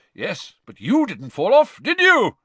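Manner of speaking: loud, high, hearty bellow; genial roar. A narrator's reading. Proud Amos teasing his son, roaring genially. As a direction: roar it with jovial pride. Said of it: "roared Amos genially".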